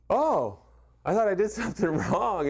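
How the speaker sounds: relieved laugh. Retold or imitated. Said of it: "And I was like".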